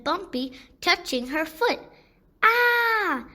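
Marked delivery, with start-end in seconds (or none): 2.4-3.3 sudden high scream, a real fright; girl's startled scream. A narrator's reading. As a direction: scream as something squishy touches your foot. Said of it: "she screams".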